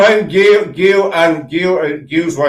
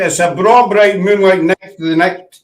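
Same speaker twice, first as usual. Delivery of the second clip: a lively Scottish accent, raised and louder; broad Scottish accent; 5 semitones higher, 5 dB louder than usual. Shown as a demonstration. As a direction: say it proud in broad Glaswegian. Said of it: "I'm Scottish".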